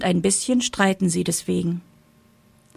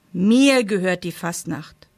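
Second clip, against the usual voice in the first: ordinary delivery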